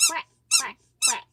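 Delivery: put-on grandma quacking to make the baby copy; duck quack. Shown as a demonstration. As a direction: show the toddler how a duck quacks. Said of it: "Do quack".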